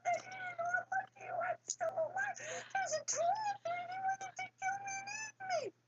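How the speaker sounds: very high, partly whispered terror; crying out in fright. Acted. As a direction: gasp it out, shaking with fright. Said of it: "crying out".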